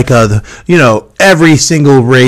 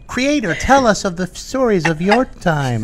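reverent creature voice; 7 semitones higher, 9 dB quieter than usual. Pretend. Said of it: "he's like".

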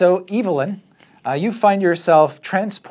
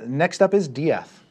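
normal talking voice

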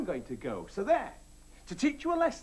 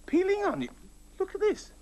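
surprised; 9 semitones higher than usual. Real, not acted. Surprised by onions excuse.

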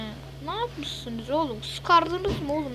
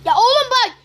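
loud, high exasperated yell; angry shout; 9 semitones higher, 11 dB louder than usual. Real, not acted. Gamer snapping at a teammate, angry shout. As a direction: blow up at him. Furious at teammate mid-match.

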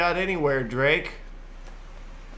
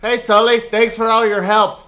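sarcastic shout; 6 semitones higher, 7 dB louder than usual. Real, not acted. Sarcastic yelling at character.

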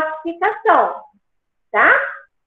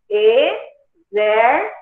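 "Exército" very slowly, broken into syllables; "Exército" as e, xér, ci, to, with pauses; 3 semitones lower, much slower than usual. Shown as a demonstration. Splits example word into syllables.

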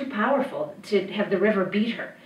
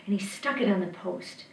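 ordinary delivery, nothing marked

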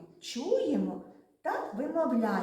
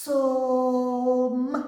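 «Сом» drawn out loud, each sound held; stretched word; 10 dB louder than usual. Shown as a demonstration. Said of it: "звуковий аналіз слова сом". («Сом» протяжно й голосно)

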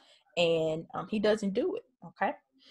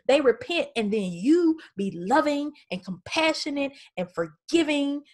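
higher pitched, indignant grumbling; exasperated Jonah; 7 semitones higher than usual. Retold or imitated. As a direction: complain about God's mercy like a sore loser. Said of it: "He's like".

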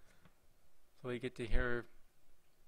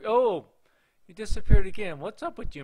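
high, loud exclamation; startled surprise; 7 semitones higher, 13 dB louder than usual. Real, not acted. Player to game character who keeps coming and going, startled. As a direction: blurt it at the vanishing figure. Figure disappeared again.

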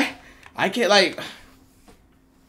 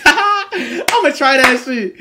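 high, loud laughing outburst; 12 semitones higher, 8 dB louder, much slower than usual. Real, not acted. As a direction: crack up while saying it. Laughing at the spitting remark.

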